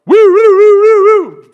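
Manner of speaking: very high, loud whooping siren; imitated police siren. Son imitating dad's cop car behind the drunk, whooping siren. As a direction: blast out a siren with your voice.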